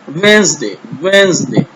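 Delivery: वेडनेसडे repeated as wens-day; silent d. Shown as a demonstration. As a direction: model वेडनेसडे without its d, wens-day, for pupils. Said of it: "डी को silent करना है". (वेडनेसडे में डी silent, वेंसडे)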